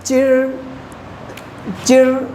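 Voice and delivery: चिर with the r curled back; चिर drilled as a teacher's example; retroflex r demo. Shown as a demonstration. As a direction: say चिर, tongue tip curled for the r. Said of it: "पढ़ेंगे इसको".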